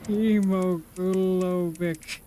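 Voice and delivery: solemn recital, word by word; put-on reading voice. Real, not acted. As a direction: read each word on its own in an odd put-on voice. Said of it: "I don't know why I'm using this voice".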